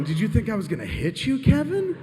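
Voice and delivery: loud, climbing to a very high pitch; imitating an affronted boss; incredulous question. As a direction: let the name shoot up in disbelief. Acted incredulous boss questioning him.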